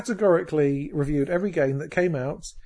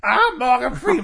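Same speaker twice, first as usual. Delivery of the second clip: a hammed-up, slow Morgan Freeman, pitched high and laughing; a bad Morgan Freeman, laughing through it; lightly voiced Morgan Freeman, high and loud; Morgan Freeman impression; 10 semitones higher, 7 dB louder than usual. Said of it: "what was the Morgan Freeman?".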